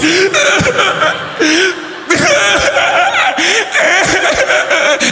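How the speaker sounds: high, heaving sobs; exaggerated comic wailing; hammed-up hard crying. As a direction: bawl in long shuddering bursts. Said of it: "you cry fucking hard".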